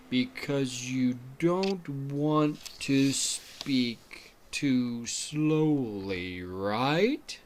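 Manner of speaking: exaggerated, dragged-out slow demo; too slowly. Shown as a demonstration. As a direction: stretch every word far too long. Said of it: "dramatization of that".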